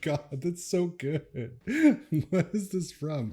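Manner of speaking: high voice breaking into real giggles; laughing delight. Real, not acted. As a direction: gasp it out between laughs. Cracking up at a meme.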